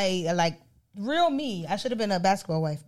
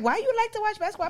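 mock question; 13 semitones higher than usual. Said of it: "people be like".

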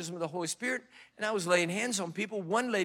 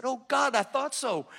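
frightened reply; 7 semitones higher, 4 dB louder than usual. Retold or imitated. Retorting in fright.

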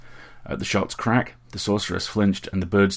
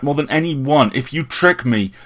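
much louder, forced through the teeth; captain forcing out his answer, much louder and seething; ground out; 7 semitones higher, 11 dB louder than usual. A narrator's reading. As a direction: grind the words out in anger. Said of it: "he ground out".

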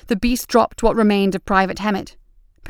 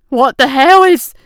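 shocked; 5 semitones higher, 9 dB louder than usual. A narrator's reading. Monster appears, Briggs shocked.

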